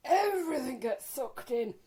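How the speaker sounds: slow, high, put-on voice mocking posers; slow and high, send up the men holding their bellies in; mock posing.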